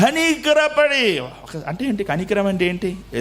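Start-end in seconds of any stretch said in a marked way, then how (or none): 0.0-1.2 కనికరపడి slow, high and falling; emphatic repeat. Shown as a demonstration. Pastor savouring the word for the church, slow and high.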